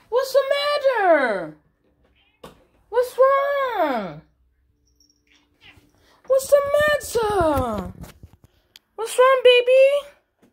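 high, drawn-out cooing with falling tails; exaggerated slow pet-owner fussing. Real, not acted. Baby talk to crying cat.

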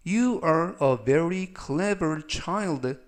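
slow, carefully spaced English sentence; slow model reading. Shown as a demonstration. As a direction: read each word slowly for learners. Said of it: "전체적 의미는".

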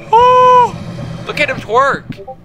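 cracking up in shock at the sight; shrieked disbelief, slowing on the last words; shocked shout. Real, not acted. Monster starts dancing at him.

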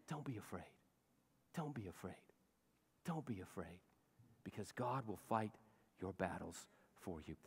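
gently calm a frightened listener; tender reassurance. Acted.

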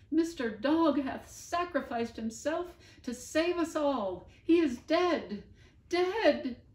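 high, loud, crying Bonaparte; tearful Bonaparte. Acted. Bonaparte clinging to a tree, telling Lionel through high loud tears. Bonaparte weeping over the dog.

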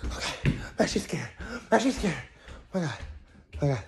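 ordinary delivery

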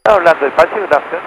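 normal talking voice

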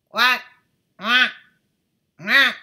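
three loud high rising quacks; loud duck quack. Acted. Duck swims off calling loud high quacks.

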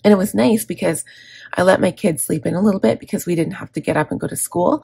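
usual speaking voice

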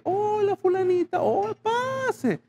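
very high, sugary fake welcome; hypocritical welcome. Wife imitates in-laws' hypocritical welcome, very high and sugary. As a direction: gush a false welcome at the door. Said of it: "la hipocresía porque".

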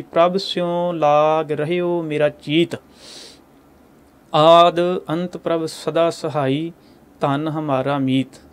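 slow, measured chanting of the verse; scripture recitation. Acted. Preacher reciting the verse to the congregation, slow and measured. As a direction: intone each word of the hymn slowly.

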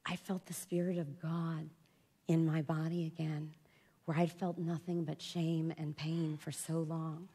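soft, whispered awe; hushed awe. Real, not acted. Woman recalling feeling God in yoga, in a hush. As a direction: whisper it like something sacred. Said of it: "spiritual moment".

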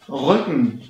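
"Rücken" with a short Ü, almost like Ö; short Ü model. Shown as a demonstration. Teacher modelling the short Ü in "Rücken". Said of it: "jetzt kommen wir zum kurzen Ü".

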